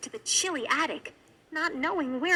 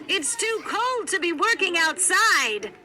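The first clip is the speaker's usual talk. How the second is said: calling out; 5 semitones higher, 5 dB louder than usual. A narrator's reading. Prince calling to gardener.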